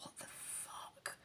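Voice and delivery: slow, breathless whispered disbelief; shocked whisper. Retold or imitated. Seeing the baby convulse, a slow stunned whisper.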